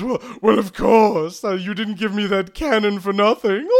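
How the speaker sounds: high, loud gloating villain; Smithy. Acted. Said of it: "Smithy goes".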